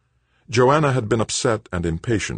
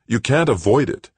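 louder, higher, firm insistence; 9 semitones higher, 6 dB louder than usual. A narrator's reading. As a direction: press it home firmly. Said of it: "Doug said firmly".

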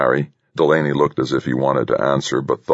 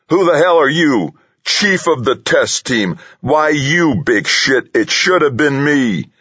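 high-pitched indignant shouting; furious outburst; 12 semitones higher, 4 dB louder than usual. A narrator's reading. Delaney resents Harry leading the test team, furious outburst. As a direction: rail at him, voice pitched way up. Delaney rages about who is chief.

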